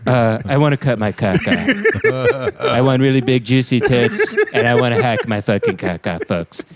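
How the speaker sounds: hokey politician impression; deliberate, put-on presidential parody voice; Donald Trump. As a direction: play the politician in a crude mash-up. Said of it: "What if Donald Trump were Caitlyn Jenner?".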